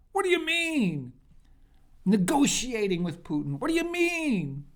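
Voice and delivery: high, mock-incredulous whine; mocking Democrats. Speaker mocking Democrats' reaction to Putin talks, high incredulous whine. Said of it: "I know the Democrats are saying".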